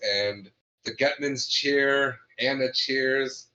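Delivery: ordinary talk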